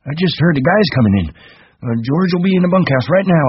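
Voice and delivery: put-on high-pitched old ranch hand; high voice, mildly comforting; reassuring Candy.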